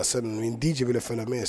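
normal talking voice

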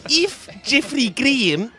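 hammed-up New Zealand accent; bad New Zealand accent. Host introducing a guest from New Zealand, bad Kiwi accent. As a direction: badly fake a Kiwi accent. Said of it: "That's such a bad accent".

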